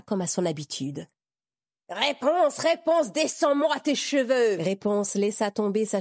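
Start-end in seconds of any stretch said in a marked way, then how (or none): 1.9-4.6 high, loud witch calling up the tower; witch shouting. Acted. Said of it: "La sorcière cria".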